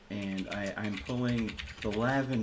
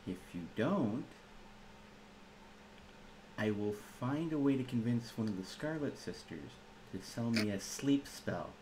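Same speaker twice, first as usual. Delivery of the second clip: soft-spoken threat; 7 dB quieter than usual. Acted. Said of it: "I've never heard sleep sound so threatening before".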